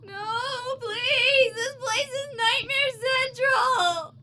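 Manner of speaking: high, slow wailing plea; terrified cry. Acted. Game character seeing Nosferatu poster, terrified wail. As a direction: beg in mock terror.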